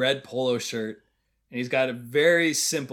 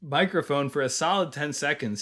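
ordinary talk, nothing marked

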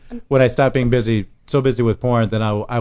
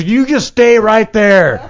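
slow, higher mock command; slow stern order; 8 semitones higher, 6 dB louder, much slower than usual. Bassist stopping him onstage, slow mock stern. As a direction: freeze him in place, slowly. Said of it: "He goes".

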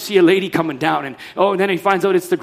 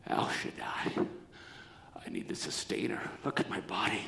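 whispered, much lower plea; hushed plea; 10 semitones lower, 18 dB quieter than usual. Acted. Old Abraham whispering his need to God. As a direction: breathe the words as a worn-out old man.

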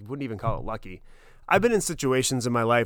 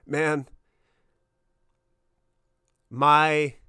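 choked up; slower than usual. Real, not acted. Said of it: "I'm getting choked up now".

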